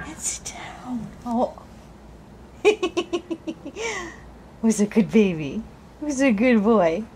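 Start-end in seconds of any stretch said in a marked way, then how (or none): 0.1-1.1 soft whisper. Real, not acted. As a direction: whisper it gently. Setting the baby back down.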